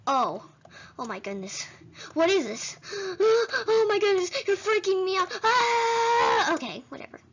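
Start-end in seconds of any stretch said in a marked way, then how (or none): 2.8-6.5 high, whimpering freak-out building to a cry; hammed-up fright with gasps; mock panic. Player freaks out at the puzzle, mock panic.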